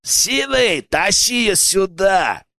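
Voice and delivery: high, slow, impatient call; disgruntled. A narrator's reading. Second accomplice ordering the woman dragged out, disgruntled. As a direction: shout it out crossly in a henchman's voice. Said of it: "недовольно буркнул второй подельник".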